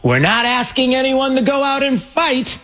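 high, lightly mocking echo of the rally line; imitating the speaker. Host mocking the rally speaker's line, lightly imitated. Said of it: "He said".

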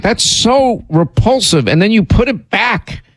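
grossed out, hamming it up; mock disgust. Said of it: "I'm like".

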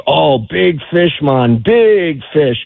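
slow, drawn-out Bahamian guide's call; Bahamian accent. Retold or imitated. Bahamian guide spots a huge fish for his client, imitated Bahamian accent. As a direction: imitate a Bahamian fishing guide, stretching the words. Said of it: "in that Bahamian accent".

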